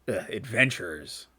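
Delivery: low-key, disdainful mutter of a guard; dismissive guard, voice falling. Acted. Guard scorning Firecrackle, disdainful. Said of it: "talking in disdain".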